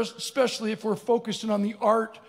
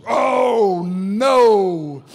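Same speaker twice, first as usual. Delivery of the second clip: loud, drawn-out bellow; mock horror; 10 dB louder than usual. Big man squeezing into the middle seat, mock horror. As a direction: bellow it long in comic dismay. Squeezing down between two men.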